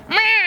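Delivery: high imitated meow, then laughter; cat meow. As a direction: meow like an insistent cat and crack up. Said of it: "and it said".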